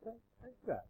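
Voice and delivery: high, hushed little plea; soft pleading tone. Shown as a demonstration. Pastor showing a third tone to Keith, hushed high plea. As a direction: ask it softly in a high, small voice. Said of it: "Or there's kind of".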